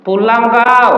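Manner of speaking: high loud yell of an angry mother. Retold or imitated. Angry mother calling her child home, shouted.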